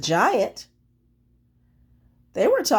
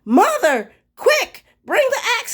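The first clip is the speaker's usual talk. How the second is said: much higher, louder urgent call; shouting; 5 dB louder than usual. Acted. Jack shouting to his mother for the axe. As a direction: yell for help in a panic. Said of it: "he shouted".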